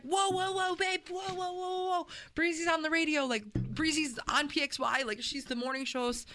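flustered husband scrambling to calm her down; panicked husband. Retold or imitated. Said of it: "And he's like".

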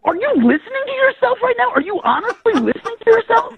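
high-pitched, exasperated demand; incredulous outrage. Real, not acted. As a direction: challenge them in real disbelief. Caller incredulous at the silly sounds.

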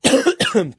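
short real coughing fit; choking cough. Real, not acted. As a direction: clear the throat after choking. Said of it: "I'm choking over here".

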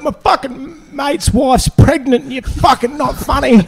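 mock heckler whine, slow and high; imitating angry heckler. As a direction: drag it out like an outraged drunk. Heckler abusing comedian.

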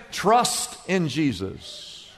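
slow, soft reassurance; gentle answer. Acted. Pastor gently answering a grieving believer. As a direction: answer the fear quietly.

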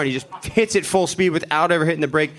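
ordinary talk, nothing marked

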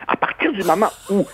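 normal talking voice

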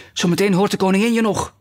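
high, worried warning; startled whisper. A narrator's reading. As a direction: warn in a fright. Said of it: "fluisterde het konijn geschrokken". (geschrokken waarschuwing)

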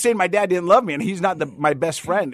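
emphatic. Real, not acted. Emphatic defence of his dad.

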